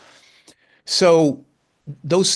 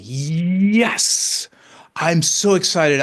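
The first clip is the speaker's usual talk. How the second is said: higher and louder, like a touchdown celebration; excited cheer; 6 semitones higher, 4 dB louder, much slower than usual. Retold or imitated. In the bathroom, celebrating his wife's success, excited cheer. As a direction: cheer it like a player who just scored. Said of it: "And I'm like".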